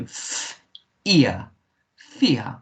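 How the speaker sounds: "f, ear, fear" split, then blended; "fear" built from separate sounds with a long ee; sounded-out E. Shown as a demonstration. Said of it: "it makes the E sound".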